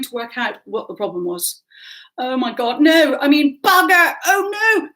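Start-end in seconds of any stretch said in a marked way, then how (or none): 2.2-4.9 high, loud, mounting dismay; flustered panic. Acted. Flustered girl answers a concerned stranger, in panic. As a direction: curse and fret, voice climbing higher.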